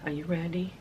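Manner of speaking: a soft, dropped, hushed question; hushed tease. Real, not acted. Teasing viewers before unwrapping the shirts, hushed. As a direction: lean in and ask it under your breath.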